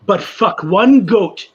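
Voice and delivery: slow, loud punchline. Acted. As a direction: drag out the punchline. Punchline of joke.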